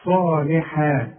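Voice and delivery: صالحا slow, the alif after ص held two beats; صالحا with the long vowel held; natural length. Shown as a demonstration. Teacher showing the natural length in صالحا. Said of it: "مد طبيعي".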